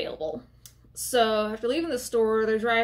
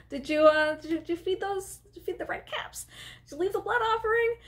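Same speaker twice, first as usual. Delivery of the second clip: much higher pointed questioning; Dorothy voice; 7 semitones higher than usual. Retold or imitated. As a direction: press her sharply about the offering.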